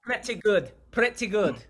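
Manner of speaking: high, loud mimicked Scottish; put-on Scottish accent. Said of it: "your Scottish accent is pretty good".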